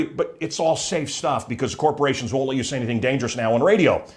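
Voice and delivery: usual speaking voice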